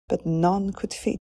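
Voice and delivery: usual speaking voice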